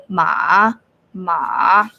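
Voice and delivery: "ma" dipped low before bouncing up; third tone, low. Shown as a demonstration. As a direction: let "ma" sink to your lowest range. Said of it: "third tone is the bouncing tone".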